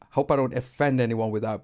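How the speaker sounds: ordinary delivery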